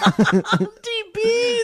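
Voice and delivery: high, slow laughing echo; laughing nickname. Pretend. [name] echoing the aunt's nickname, high slow laughing. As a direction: stretch it out through laughter.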